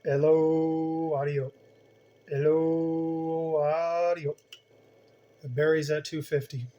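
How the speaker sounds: drawn-out hello keyed into the mic; mic check hello on the CB; radio test call. Shown as a demonstration. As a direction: call out a test count over the air. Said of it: "watch what happens when I modulate, when I talk in it".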